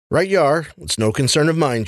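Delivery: mock agreement with a snort; snorting dismissal. A narrator's reading. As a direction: toss it aside with a snort. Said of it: "she snorted".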